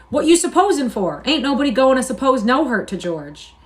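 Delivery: high, loud, threatening; looming over him; menacing anger. A narrator's reading. Lenny standing over Crooks.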